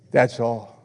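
ordinary talk

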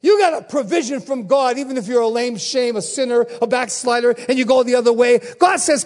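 ordinary talk, nothing marked

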